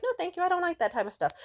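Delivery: quick, high, polite brush-off; fast high-pitched polite decline; polite refusal. Retold or imitated. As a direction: rush out a polite no. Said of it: "I'll be like".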